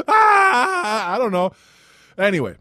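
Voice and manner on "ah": high, loud wailing "aah"; hammed-up panicked scream; mock scream. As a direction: scream it out in exaggerated horror. Mock scream after the slogan.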